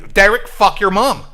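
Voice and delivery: high, loud mock outburst; acted streamer losing his temper; DSP. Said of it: "I think I might be losing it".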